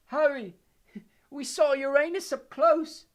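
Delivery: high, loud dazed giggle, slow; giggling feebly. A narrator's reading. Ron, cursed, giggles feebly at his pun. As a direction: laugh at your own joke, woozy. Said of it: "still giggling feebly".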